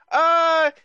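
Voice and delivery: loud, slow, ringing town crier call. Acted. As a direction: hawk slaves to the whole square. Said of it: "He pulls out his bell and he starts ringing it".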